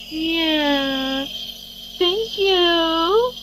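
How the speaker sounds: high, drawn-out gushing at a dog; cooing pet talk. Real, not acted. Owner praising the dog watching with her, cooing. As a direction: coo adoringly, stretching the words.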